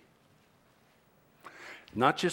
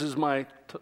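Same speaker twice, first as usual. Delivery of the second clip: usual speaking voice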